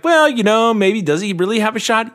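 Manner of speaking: doubting fan. Acted. Host imitating fans who doubt Tony's chances, skeptical. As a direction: put on a skeptical fan's hedging. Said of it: "and you're like".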